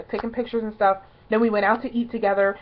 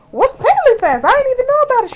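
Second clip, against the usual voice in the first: high, loud disbelief; shocked; 7 dB louder than usual. Retold or imitated. Fan learning of an event, loud high disbelief.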